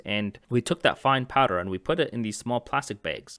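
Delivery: normal talking voice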